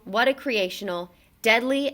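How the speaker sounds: ordinary delivery, nothing marked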